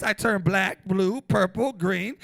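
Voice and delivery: ordinary talk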